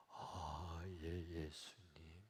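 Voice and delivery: very low, soft acknowledgement; humbled, hushed. Acted. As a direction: murmur it as if newly realizing. Acted deacon humbled by Jesus' answer. (낮게 잦아드는 목소리로)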